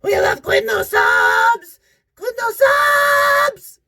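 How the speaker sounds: very high, slow, loud squeaky singsong; atrocious creature voice. Retold or imitated. YouTuber imitates the Quiznos ad's creatures, very high squeaky singsong. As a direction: squeal it like a tiny creepy hamster. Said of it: "I'll try to do it".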